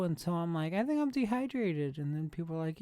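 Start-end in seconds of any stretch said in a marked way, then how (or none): 0.7-2.0 much higher, dazed "I think I'm dehydrated"; mock exhausted. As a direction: play your spent cyclist self lightly. Exhausted cyclist quoting self.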